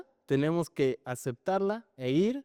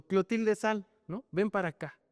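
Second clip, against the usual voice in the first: usual speaking voice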